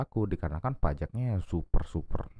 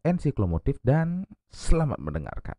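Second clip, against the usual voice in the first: podcast intro; 7 semitones higher, 6 dB louder than usual. Acted. Podcast opening announcement.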